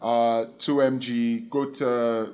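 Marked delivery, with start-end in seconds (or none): none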